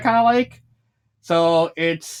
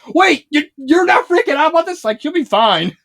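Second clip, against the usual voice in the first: higher, louder disbelieving question; mock disbelief; 9 semitones higher, 5 dB louder than usual. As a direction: act stunned that nobody panics. Misty shocked, Kai unbothered.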